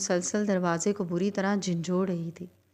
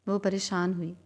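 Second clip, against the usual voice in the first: ordinary delivery, nothing marked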